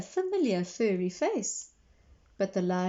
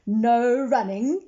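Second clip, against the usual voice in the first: slow, louder playful reminder; calling after; 2 semitones higher, 8 dB louder, slower than usual. A narrator's reading. McBee calls after running Miss Merriweather, slow and loud. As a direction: call the rule after her, teasing. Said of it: "he called after her".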